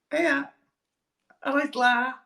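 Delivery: very high Scouse greeting; Scouse accent. Shown as a demonstration. Comedian having another go, high-pitched Scouse accent. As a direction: squeak out a badly done Scouse hello. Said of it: "can you do a Scouse accent?".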